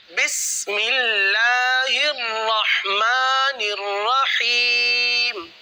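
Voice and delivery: very slow, drawn-out Quranic recitation. Shown as a demonstration. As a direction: recite each sound clearly and slowly. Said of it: "تو اس کو درست ادا کرتے ہوئے پڑھیں گے". (آہستہ تجوید کے ساتھ تلاوت)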